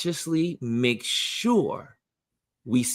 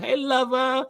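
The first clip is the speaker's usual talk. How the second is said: "Hey" pitched way up, playful; high hey lover; 11 semitones higher than usual. Retold or imitated. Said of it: "She's like".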